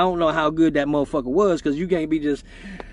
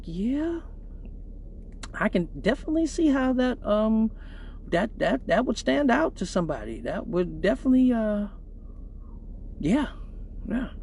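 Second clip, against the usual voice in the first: awkward quiet reply; 2 semitones higher, 7 dB quieter than usual. Retold or imitated. His awkward reply to friend.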